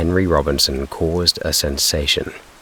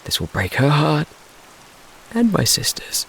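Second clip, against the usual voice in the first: put-on high distraught boy; 12 semitones higher than usual. Slim grieving.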